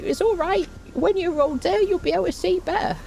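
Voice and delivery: high put-on voice, a touch fast; mock the childhood optician in a high voice; silly optician voice. Imitating the optician who promised better eyes, high and silly. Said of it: "why I said that in that voice".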